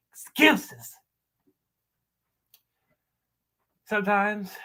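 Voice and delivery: slow, theatrical dressing-down with wide pitch swings; scornful monologue. Acted. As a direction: berate someone in measured, acted bursts, punching key words. Performs audition monologue.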